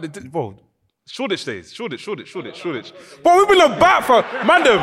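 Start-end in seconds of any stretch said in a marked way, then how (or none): 3.2-4.8 excited. Real, not acted. Excited greeting old friend.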